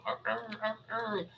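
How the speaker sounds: high, soft muffled howls from behind the walls; a pack howling from inside a building. Acted. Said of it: "they kinda all howl from the inside".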